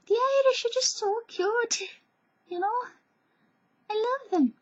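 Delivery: very high, loud adoring voice; gushing squeal. Real, not acted. Vlogger gushing over the Irish, high adoring squeal.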